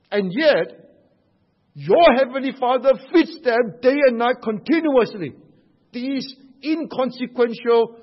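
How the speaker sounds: rising sermon fervor; fervent preaching. Acted. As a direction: preach it to the back row with conviction. Preaching at full force.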